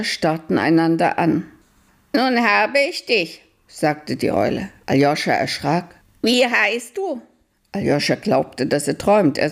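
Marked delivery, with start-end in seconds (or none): 2.2-3.4 high owl voice, calm; a high, patient old owl; wise owl. Acted. Owl finds Aljoscha. 6.2-7.2 high owl voice, calm; a high, patient old owl; wise owl. Acted. Owl asks Aljoscha.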